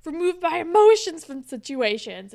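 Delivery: lightly hammed-up annoyed retort; retold mad. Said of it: "And everyone's mad".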